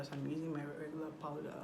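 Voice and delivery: ordinary talk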